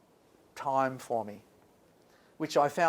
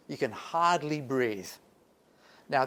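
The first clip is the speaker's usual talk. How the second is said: usual speaking voice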